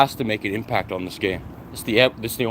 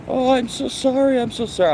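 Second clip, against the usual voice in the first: imitating a fussy dog owner in a much higher voice; mock apologetic owner; 14 semitones higher than usual. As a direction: squeak out the apology like the flustered owners.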